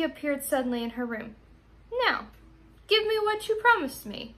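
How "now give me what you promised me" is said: high, insistent demand from the little man; a hint of a greedy little man demanding. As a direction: claim the prize sharply, pitched up. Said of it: "he demanded".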